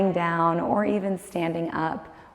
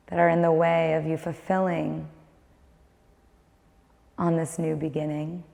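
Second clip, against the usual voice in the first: guided meditation; 6 dB quieter than usual. Acted. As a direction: guide the listener inward, calm and unhurried.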